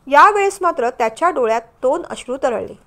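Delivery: ordinary delivery, nothing marked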